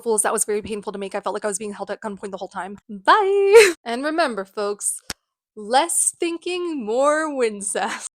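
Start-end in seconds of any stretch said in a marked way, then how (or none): 2.9-5.0 loud, high-pitched drawn-out bye; exaggerated sign-off. Mock cheery sign-off of prank video. 5.6-8.0 very slow, stretched sign-off motto; drawn-out slogan. Acted. Drawn-out slogan closing the prank.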